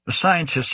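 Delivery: "scientists" given faint, pointed emphasis; slight stress on SCIENTISTS. A narrator's reading. Director talking of researchers to a scientist, slight stress on "scientists". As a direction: lean ever so slightly on "scientists". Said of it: "stressing the word scientists ever so slightly".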